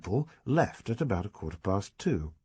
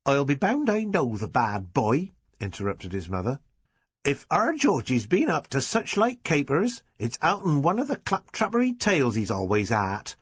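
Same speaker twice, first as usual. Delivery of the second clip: higher, louder rural mum's complaint; indignant country mother; 7 semitones higher, 4 dB louder than usual. A narrator's reading. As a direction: scold the trashy tales like a fed-up mum. Mother blames comic books.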